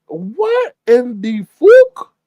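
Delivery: slow, high, wailing disbelief; aghast. Retold or imitated. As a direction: stretch every word, scandalized. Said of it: "I was like".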